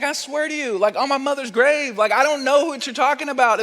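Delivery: high-pitched, insistent swearing; hammed-up plea to be believed; protesting oath. Peter swearing to his accusers, vehemently protesting.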